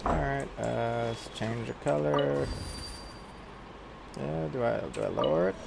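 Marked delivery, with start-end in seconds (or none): none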